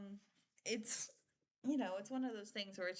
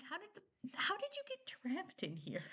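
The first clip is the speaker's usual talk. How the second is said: incredulous; 11 semitones higher than usual. Retold or imitated. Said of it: "'cause I'm like".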